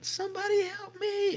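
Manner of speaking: very high, unchanging eerie cry. Retold or imitated. Storyteller repeating the voice from the woods, very high eerie cry. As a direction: repeat the strange call in exactly the same tone.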